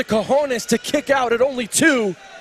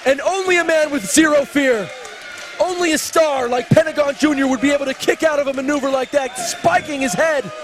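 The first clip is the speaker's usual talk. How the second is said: excited shouting; 4 semitones higher than usual. Real, not acted. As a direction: yell it as the crowd erupts. Kickout, commentator yelling.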